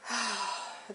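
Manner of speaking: soft, breathy exhale; sigh of relief. Real, not acted. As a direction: let out a real sigh. Relief at feeling better soon.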